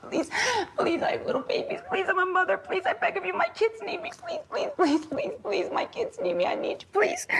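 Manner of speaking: tearful begging, high and desperate; sobbing plea. Retold or imitated. Mother begging the gunmen for her life, sobbing. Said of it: "sobbing, going".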